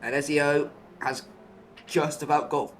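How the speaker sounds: lively live play-by-play call; motorsport commentator. Acted. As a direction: call the overtake as it happens.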